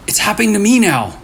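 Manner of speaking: frightened Leo. Acted. Leo calls Gia, frightened that the signal found him. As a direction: sound the dread breaking through.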